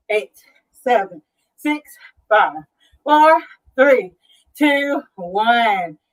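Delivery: usual speaking voice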